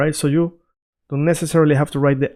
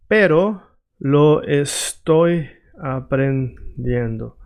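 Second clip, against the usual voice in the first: very slow, word by word; syllable-by-syllable teaching pace; slow demo; much slower than usual. Shown as a demonstration. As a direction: draw out each Spanish word for a learner. Models phrase slowly for learners.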